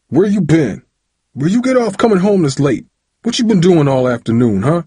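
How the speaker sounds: high, forceful father's grilling; angry interrogation. A narrator's reading. Father confronts son coming home late, angry grilling. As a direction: throw each question like a punch. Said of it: "threw questions at Tyree like swift punches".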